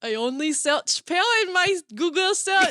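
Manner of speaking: high, laughing French accent. Real, not acted. Host confessing a Google search, laughing. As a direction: protest through giggles in a French accent.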